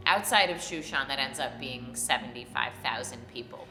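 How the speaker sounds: usual speaking voice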